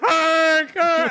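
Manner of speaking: high short mock yelps; imitating the guy's alarmed cries; startled yelps. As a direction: yelp like the startled driver. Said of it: "And the guy's like".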